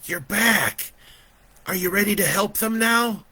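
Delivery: put-on high, loud, eager child; high-pitched boy; little boy's voice. A narrator's reading. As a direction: ask urgently as a small boy. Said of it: "that little boy's voice".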